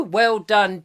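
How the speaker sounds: normal talking voice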